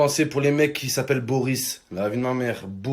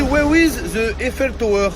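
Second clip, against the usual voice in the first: put-on English accent; 8 semitones higher, 4 dB louder than usual. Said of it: "elle me dit".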